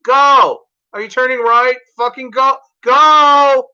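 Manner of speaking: loud, high, impatient yelling; yelling at driver. Retold or imitated. Yelling at the driver who won't turn. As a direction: shout at the stalled car ahead to go. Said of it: "I'll be yelling at him".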